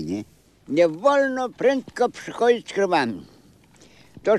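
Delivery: ordinary talk, nothing marked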